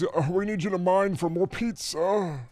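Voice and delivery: high, slow, drawn-out caricature; mock cheese-cave figure. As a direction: moan the order out in a silly put-on voice. Acted boss in the cheese caves.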